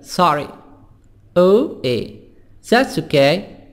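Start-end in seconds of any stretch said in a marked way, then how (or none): 0.0-0.5 SORRY said the American way, open 'ah' vowel; American-accented 'sorry'; American pronunciation. Shown as a demonstration. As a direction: say SORRY as 'sah-ree', American style.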